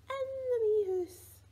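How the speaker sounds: high, loud playful squeal on the rhyme's last line; excited squeal. Pretend. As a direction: burst up into a delighted squeal as the tickle lands. Rhyme climax, tickling baby.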